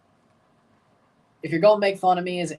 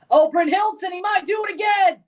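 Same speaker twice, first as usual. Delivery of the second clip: high, loud burst of live commentary; excited shout; 12 semitones higher, 4 dB louder than usual. Real, not acted. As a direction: shout it as the chance suddenly opens up. Live chance in the match.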